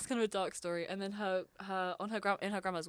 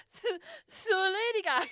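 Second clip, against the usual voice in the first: attempted Irish accent; 8 semitones higher, 3 dB louder than usual. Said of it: "I need to get into my Irish accent".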